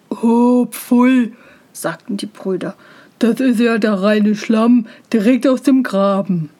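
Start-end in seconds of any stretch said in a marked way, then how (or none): 0.1-1.3 loud, drawn-out disgust; disgusted brothers. A narrator's reading. 3.2-6.5 firmly projected scorn; disgusted brothers. A narrator's reading.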